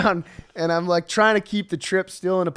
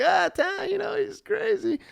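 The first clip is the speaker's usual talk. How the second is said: high, light, fake-breezy voice; forced cheerful excuse; 14 semitones higher, 3 dB quieter than usual. Smoothing things over with passing strangers, fake-breezy. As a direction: play it off to strangers with a strained grin. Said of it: "put a good face on it".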